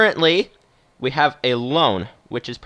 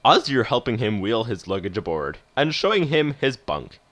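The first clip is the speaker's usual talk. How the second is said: measured story narration, unhurried; composed game-story narrator; proper narrator voice. A narrator's reading. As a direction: settle into a storyteller's reading voice. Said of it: "get into the proper voice for this one".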